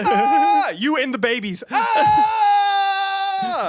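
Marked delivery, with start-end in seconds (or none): 1.7-3.7 high loud hammed-up scream; mock terrified scream. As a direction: shriek like the plane is crashing. Acting terror at landing.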